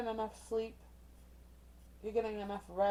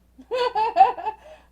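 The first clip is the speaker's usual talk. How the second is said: high, loud burst of laughter; loud cackle; 12 semitones higher, 15 dB louder than usual. Real, not acted. Reader tickled by grandmother's crude saying, loud cackle. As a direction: crack up at your own joke.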